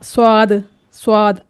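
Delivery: स्वाद with a w glide, swaad; added waw. Shown as a demonstration. Teacher imitates people's mistake, w slipped into स्वाद. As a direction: round the lips into a w in स्वाद. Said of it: "वाव का इजाफा कर देते हैं".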